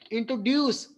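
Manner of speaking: "Introduce" with a light intro, firm ड्यूस; stress on ड्यूस. Shown as a demonstration. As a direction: put force on the ड्यूस of "introduce". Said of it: "ड्यूस पे आपको emphasise करना है". ("Introduce" में ड्यूस पर ज़ोर)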